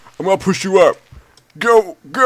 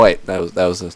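ordinary delivery

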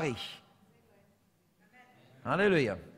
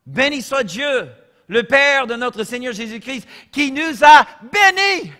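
fervent preacher's shout; preaching at full force; 5 semitones higher, 9 dB louder than usual. Acted. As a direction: thunder out the blessing to the church. Preacher proclaiming the verse.

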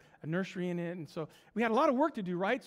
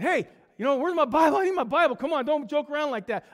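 loud, raised-pitch protest; retold agitated demand; 5 semitones higher, 10 dB louder than usual. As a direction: demand it back from the pranksters. Demands Bible back from joking friends.

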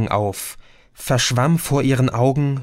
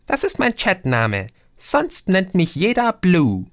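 high, puzzled little being; tiny creature voice; 7 semitones higher than usual. A narrator's reading. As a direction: squeak it like a tiny fairy, puzzled by the word. Creature Blue answers. (hohe, verwunderte Wesen-Stimme)

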